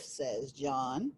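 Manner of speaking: usual speaking voice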